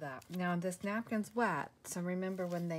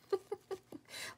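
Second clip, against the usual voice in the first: short high chuckle; laughing at her own mishap; 14 semitones higher, 4 dB quieter than usual. Real, not acted. Crafter tells viewers about her glue mishap, laughing.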